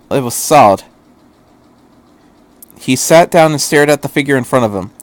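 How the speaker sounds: usual speaking voice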